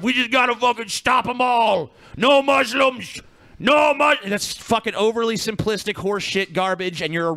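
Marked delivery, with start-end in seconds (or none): 0.0-4.2 higher, louder mock shout of a bigot. Mocking right-wing populists with a put-on shout. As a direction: ham up a ranting bigot, slowing on the slogans. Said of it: "overly simplistic answer of".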